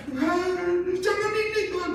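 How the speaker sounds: high-pitched imitated plea; mock pleading. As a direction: beg God in a put-on high voice. Meniru orang memohon kepada Tuhan. (rengekan memohon yang ditirukan)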